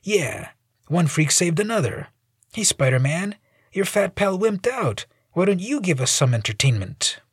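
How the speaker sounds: lightly voiced mocking smart aleck. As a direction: jeer at the hero. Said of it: "The smart aleck approaches".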